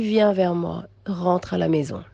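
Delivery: low and soft, calling someone home; tender low invitation to come home; hushed call home. Acted. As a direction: murmur it like a loving summons. Lion calls her home.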